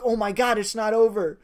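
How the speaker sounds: excited fan outburst; thrilled relief. Retold or imitated. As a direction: cry it out as the comeback starts. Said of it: "You're like".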